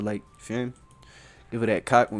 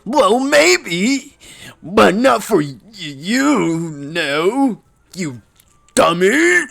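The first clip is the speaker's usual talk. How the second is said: put-on high, loud huffy girl voice; flustered tsundere girl; 9 semitones higher, 9 dB louder, much slower than usual. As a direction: snap it like a flustered schoolgirl.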